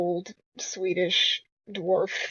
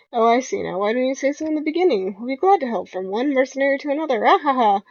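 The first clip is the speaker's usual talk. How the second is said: higher, louder Australian excitement with a laugh; eager Junkrat, sold on the plan; Junkrat cheery; 4 semitones higher, 5 dB louder than usual. Acted. Junkrat agrees to help the mercenaries, higher excited cackle. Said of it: "the laughing Australian".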